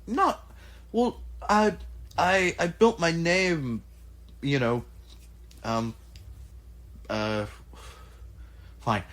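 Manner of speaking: put-on high, hesitant old craftsman rambling; Ajax's voice. Ajax answering the party, high and hesitant. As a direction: go up high and fumble for words, then settle. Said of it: "He, he turns to you and goes".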